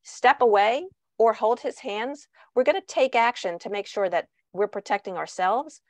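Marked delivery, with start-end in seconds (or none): none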